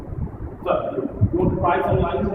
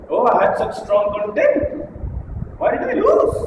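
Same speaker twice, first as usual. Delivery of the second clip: drawn-out bewilderment, leaping high at the end; puzzled wondering; 8 semitones higher than usual. Acted. Coach voicing a losing speaker who wonders why, puzzled. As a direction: wonder aloud, pitch shooting up on the question. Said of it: "And then they wonder".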